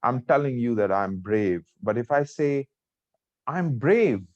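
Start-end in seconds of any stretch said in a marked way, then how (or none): 3.5-4.3 higher-pitched angry assertion. Shown as a demonstration. Teacher demonstrating a tone for students, angry. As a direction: insist it with a flash of anger. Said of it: "so I'm angry".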